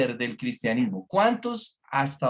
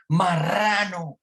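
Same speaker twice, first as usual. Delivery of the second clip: high, drawn-out mock yell; retold shouted retort; 4 semitones higher, much slower than usual. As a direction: imitate a driver hollering out the window. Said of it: "el otro sacó la cabeza y dijo".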